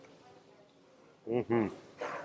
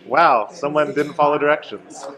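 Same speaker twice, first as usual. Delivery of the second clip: mock chef remark; 4 semitones higher, 6 dB louder than usual. Mocking the chef's verdict.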